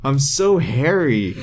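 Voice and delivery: slow, mock-mournful lament; Beast, weird creeper. Beast lamenting to Mystique, hammed-up and mournful. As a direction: drag it out in comic self-pity. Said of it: "Like Beast being, like, a weird creeper".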